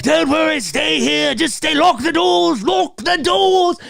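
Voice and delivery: put-on high, panicked old protector's voice; urgent put-on cry, stretching the last words; frantic character impression; Loomis. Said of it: "Loomis is like".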